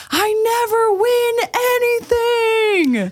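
hammed-up joyful yell at the top of the lungs; screamed. Husband screaming about his prize, mock ecstatic yell. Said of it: "screamed at the top of his lungs".

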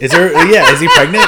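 high, loud, laughing question; laughing disbelief. Real, not acted. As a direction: burst out laughing as you ask. Look-up confirms the absurd film.

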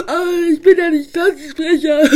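hammed-up sobbing with laughter; blubbering. Spike blubbers to Piper that he didn't win. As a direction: cry a lake over losing. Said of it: "Spike heulte ein See".

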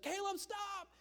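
wife yelling stop. Retold or imitated. Wife outside yelling for Caleb to stop the truck, alarmed shout. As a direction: shout it to stop him now. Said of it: "she's like".

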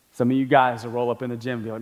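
ordinary talk, nothing marked

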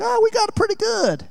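mock-sweet crowd voice; mock congregation. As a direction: squeak it like a smug congregant.

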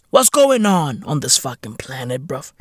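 normal talking voice

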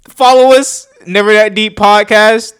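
loud hype call to follow; loud shoutout. Real, not acted. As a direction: shout the podcast plug. Loud podcast plug.

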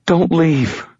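high, pleading whimper; whimpering plea. A narrator's reading. Injured girl begs the narrator to stay, high whimper. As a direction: beg him not to go, a frightened girl.

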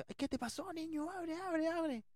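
high-pitched worried mom voice; alarmed mother. Retold or imitated. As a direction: do a frantic mother calling through a door. Mother alarmed at locked door.

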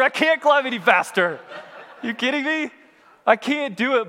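high, exasperated mock outburst; panicked protest. Stuck climber protesting to his brother above, panicked and incredulous. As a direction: protest in hammed-up desperate disbelief.

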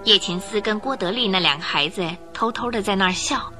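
ordinary delivery

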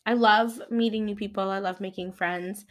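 usual speaking voice

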